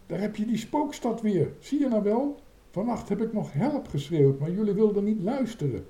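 put-on shrill-pitched, upset child's outcry; worked-up high-voiced complaint; high yelling. A narrator's reading. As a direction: yell it high, accusing the others. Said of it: "en gilde".

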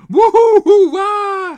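schelle, lange gil van schrik. A narrator's reading. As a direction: gil het uit als een geschrokken dame. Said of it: "gilde ze". (hoge, uitgerekte angstkreet)

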